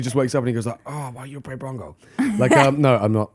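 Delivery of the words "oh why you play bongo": soft mock Korean-accented complaint; groggy protest in an imitated Korean accent; put-on Korean accent. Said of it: "I'm gonna inadvertently do the accent".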